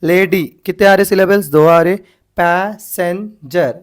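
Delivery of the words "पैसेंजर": "पैसेंजर" split slowly as pa-sen-jer; three syllables. Shown as a demonstration. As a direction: count out "पैसेंजर" in three slow beats. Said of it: "तीन आ रहे ना यहाँ पे syllables". ("पैसेंजर" तीन हिस्सों में, धीरे)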